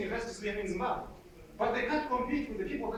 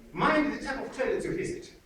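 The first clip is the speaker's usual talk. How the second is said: ordinary delivery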